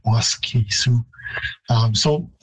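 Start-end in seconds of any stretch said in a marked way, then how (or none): 0.1-1.0 Waskisu with the "ee" held long; Cree-style Waskisu, first-syllable stress and full middle vowel. Shown as a demonstration. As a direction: say Waskisu as WAS-kee-soo. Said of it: "it would be a longer vowel in Cree".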